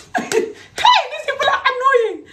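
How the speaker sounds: high-pitched, helpless laughter through the words; laughing. Real, not acted. Woman scoffs at men's claims about women, laughing. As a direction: crack up while complaining.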